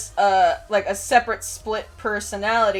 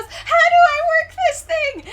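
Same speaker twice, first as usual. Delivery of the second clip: put-on very high, loud girlish panic; mock panic; 6 dB louder than usual. Elf girl fumbling with a flare, squealing panic. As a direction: squeal it like a flustered little girl. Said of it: "she panics".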